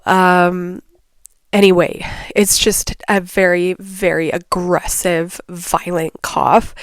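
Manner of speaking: usual speaking voice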